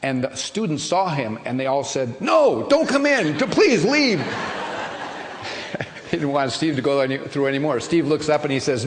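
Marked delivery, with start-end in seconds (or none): 2.2-4.2 high, slow pleading plea; pleading crowd. Acted. Students beg the newcomer to leave, high pleading. 4.2-6.0 soft laugh. Real, not acted. Speaker laughs at own telling.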